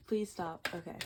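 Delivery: normal talking voice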